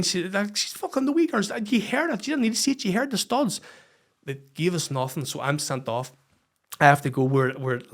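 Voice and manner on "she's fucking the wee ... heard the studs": high-pitched protest; indignant pleading. Retold or imitated. Coach arguing to linesman for injured girl, high indignant protest. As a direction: argue the case, voice pitched up.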